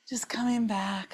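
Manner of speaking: soft whisper; breathy, hushed cue. Real, not acted. Winding down after movement.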